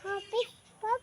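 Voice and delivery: quiet repeat after adult; softly repeated. Shown as a demonstration. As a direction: say it softly back. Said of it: "sabihin mo, "Wapis". (mahinang pag-uulit)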